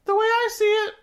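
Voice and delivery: high, eager cartoon helper voice, laughing through it; Mister Meeksy voice. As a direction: squeak it up like a chipper little helper who exists to serve. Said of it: "one Mister Meeksy's like".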